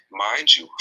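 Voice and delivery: ordinary talk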